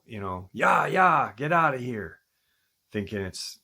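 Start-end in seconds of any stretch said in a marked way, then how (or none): 0.5-2.2 higher, louder shout to scare off an animal; imitating a man yelling into the dark; retold yell. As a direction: shout it out like shooing a coyote away. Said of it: "He yells out".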